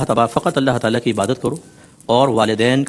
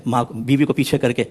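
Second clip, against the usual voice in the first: normal talking voice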